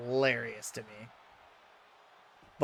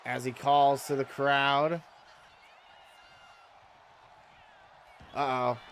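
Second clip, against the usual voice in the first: wrestling commentator; 5 semitones higher, slower than usual. Acted. Live wrestling game play-by-play.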